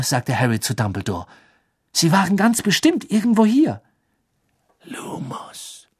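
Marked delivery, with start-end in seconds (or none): 1.9-3.8 high, emphatic protest; anxious insistence. Acted. Harry assuring Dumbledore in the forest, anxiously insistent. 4.8-5.9 soft, breath-only incantation; whispered spell. Acted. Dumbledore lighting his wand in the forest, whispering.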